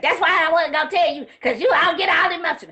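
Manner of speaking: loud, rushed mock scolding; retold teasing scolding. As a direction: fuss at an emotional friend, loud and fast. Friend's scolding reply, retold.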